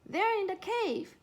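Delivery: high, loud urgent cry. A narrator's reading. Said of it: "she cried".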